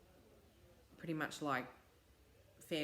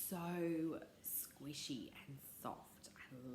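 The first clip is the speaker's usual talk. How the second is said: slow breathy whisper of delight; 4 semitones lower, 11 dB quieter, much slower than usual. Real, not acted. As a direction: whisper it lovingly, slowly. Stroking soft finished blanket.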